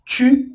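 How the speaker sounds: tu with rounded lips and I sound; French tu. Shown as a demonstration. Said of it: "alguns exemplos".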